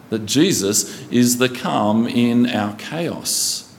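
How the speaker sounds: ordinary delivery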